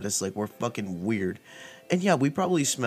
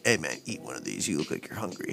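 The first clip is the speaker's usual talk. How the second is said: low, softer buddy voice; laid-back friend; 4 semitones lower, 5 dB quieter than usual. Retold or imitated. Buddy handing over a burger, low and easygoing. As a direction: imitate a pal's casual offer, pitched down.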